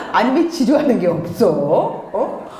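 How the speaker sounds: understated puzzled questioning; lightly acted puzzlement; mildly baffled mother. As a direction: ask in mild bewilderment. Acts baffled mother, first take.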